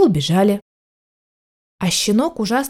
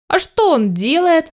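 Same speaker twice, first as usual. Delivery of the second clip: higher, slow, mildly loud question; loud puppy question; 6 semitones higher than usual. A narrator's reading. Puppy asks about the lurking cat, mildly loud. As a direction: ask openly, a touch too loudly. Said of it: "громко спросил щенок".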